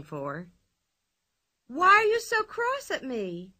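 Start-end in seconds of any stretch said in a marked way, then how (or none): 1.7-3.5 much higher, mock hurt question; reproachful. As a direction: pout at the angry policemen. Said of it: "asked Pippi reproachfully".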